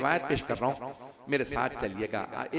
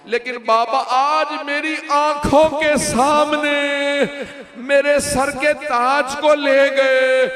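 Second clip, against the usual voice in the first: weeping plea; 6 semitones higher, 8 dB louder than usual. Acted. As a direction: cry it out as a daughter at the grave. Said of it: "रो रो के कहने लगी". (रोते हुए बाबा से फ़रियाद)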